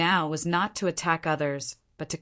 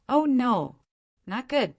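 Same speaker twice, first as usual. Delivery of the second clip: mild narrated scream, pitched up; higher, understated cry of panic; panicked cry; 6 semitones higher than usual. A narrator's reading. Maid retreating from the well in panic, mildly screamed. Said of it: "screamed shrilly".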